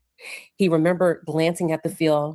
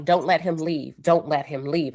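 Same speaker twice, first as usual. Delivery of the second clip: ordinary talk, nothing marked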